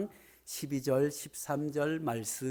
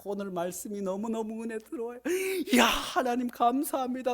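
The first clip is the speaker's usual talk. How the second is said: higher, fast gushing thanks; moved believer; 9 semitones higher, 3 dB louder, faster than usual. Retold or imitated. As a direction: act out a churchgoer overflowing with joy. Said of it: "또 어떤 분들은".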